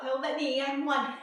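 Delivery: ordinary talk, nothing marked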